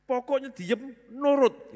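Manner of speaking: loud, high mock scolding; old-school father ordering the child to obey, loud; stern parent. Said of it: "Zaman saya dulu".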